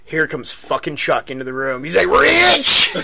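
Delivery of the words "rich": high, loud Southern holler; Southern accent. Retold or imitated. Chuck spotting Rich years later, loud Southern holler. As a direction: call out gleefully as an imitated Southern guy. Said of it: "He's a Southern guy".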